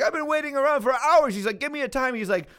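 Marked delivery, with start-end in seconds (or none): 0.0-1.3 much higher, louder mock complaining voice; shrill phone-complaint parody; nagging caller. Customer on phone quoted again. 1.6-2.1 nagging caller. Retold or imitated. Customer on phone quoted.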